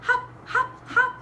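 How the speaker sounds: high, bouncy little hops; bunny hopping. Acted. Storyteller imitates Bunny coming, high hopping. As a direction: pop each hop out high. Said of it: "It sounded like this".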